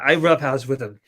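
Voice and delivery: ordinary talk, nothing marked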